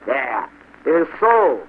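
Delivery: rising to a loud insistence; insistent cry. A narrator's reading. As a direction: cry out in vindication. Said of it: "cried the Hessian".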